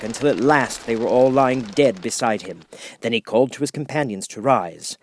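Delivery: plain reading voice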